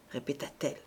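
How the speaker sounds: plain reading voice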